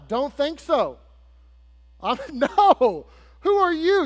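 high, loud, mock-offended comeback; indignant refusal. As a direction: play up the outrage, pitched well above normal talk. Acted indignant refusal by Jephthah.

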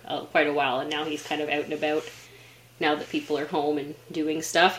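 ordinary delivery

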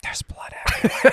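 deadpan joke whisper; ASMR whisper. Pretend. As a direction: whisper it with mock horror. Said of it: "going all full A S M R here".

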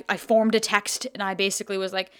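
ordinary talk, nothing marked

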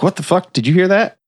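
high mock-startled questions. Shop owner to Bill after a sound, mock startled. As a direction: imitate being alarmed by a noise.

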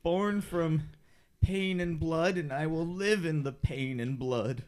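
a brute boasting, low and heavy; deep menacing voice. Acted. Pit fighter Carmichael boasting.

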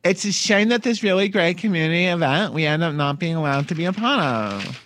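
mock a Staten Island local's complaint; Staten Island accent. Host quoting Miss Staten Island in a Staten Island accent. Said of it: "let me do a Staten Island accent".

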